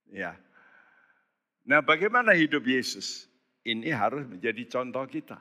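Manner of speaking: ordinary talk, nothing marked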